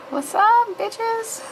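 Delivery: high, cocky victory greeting; triumphant. Real, not acted. Vlogger gloating to viewers after winning a spot, triumphant. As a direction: crow it at the viewers.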